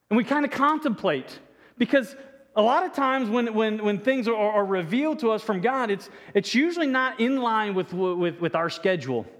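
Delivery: ordinary talk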